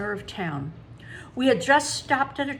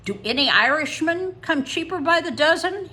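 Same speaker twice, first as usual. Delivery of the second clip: joking dad; 7 semitones higher, 6 dB louder than usual. A narrator's reading. Dad's stock joke at tollbooth.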